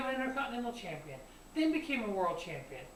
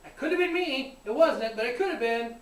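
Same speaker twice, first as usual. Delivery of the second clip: loud, high shocked cry; shocked shout; 4 semitones higher, 9 dB louder than usual. Retold or imitated. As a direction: shout it in disbelief. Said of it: "that's how shocked I was".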